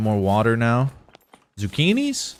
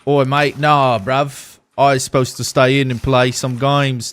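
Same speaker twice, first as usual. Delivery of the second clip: a louder, playful mock Australian accent; 4 semitones higher, 7 dB louder than usual. Streamer answering a viewer in a mock Australian accent. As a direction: toss it off like a cheeky Aussie bloke. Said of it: "Why am I doing Australian accent?".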